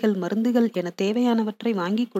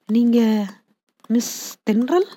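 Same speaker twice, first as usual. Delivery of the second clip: ordinary delivery, nothing marked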